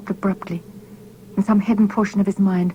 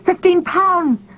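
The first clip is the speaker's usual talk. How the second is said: high, loud, slow shout; 12 semitones higher, 5 dB louder, slower than usual. A narrator's reading. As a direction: cry it out in horror, pitched way up. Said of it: "It came out now as a shout".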